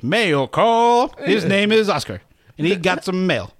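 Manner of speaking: hammed-up, drawn-out announcing voice; imitating Andy Bernard. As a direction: call it out high like an office herald. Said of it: "is Andy goes".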